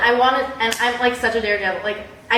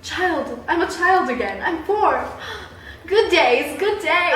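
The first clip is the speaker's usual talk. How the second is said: nostalgic gush; 6 semitones higher, slower than usual. Retold or imitated. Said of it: "I'm like".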